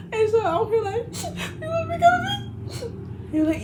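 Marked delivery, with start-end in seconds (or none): 1.6-3.0 high, tearful whimpering voice; crying. Retold or imitated. To her boyfriend on the phone, tearful crying voice. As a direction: plead through tears.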